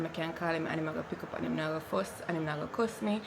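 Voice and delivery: ordinary delivery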